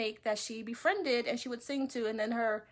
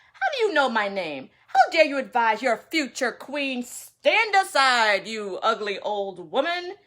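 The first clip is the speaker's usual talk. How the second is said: loud arrogant scolding; 9 dB louder than usual. Acted. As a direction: berate the old woman loudly. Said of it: "Manyar scolded".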